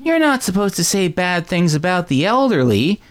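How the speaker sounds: put-on high, slow child's voice; scolding little boy. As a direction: tell Mommy off like a prim kid. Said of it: "Billy's like".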